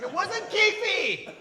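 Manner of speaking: high, drawn-out squeal; squealed confession under torture; pained high squeal. Acted.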